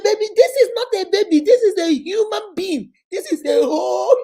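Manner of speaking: fast, high, loud scolding. Retold or imitated. Mother scolding the toddler that it's a human being, loud and fast. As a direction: tell the child off hard. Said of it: "I said".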